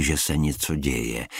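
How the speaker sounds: ordinary delivery, nothing marked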